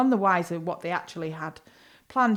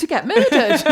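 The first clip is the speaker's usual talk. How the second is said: laughing; 6 semitones higher, 5 dB louder than usual. Real, not acted. Joking about murderers.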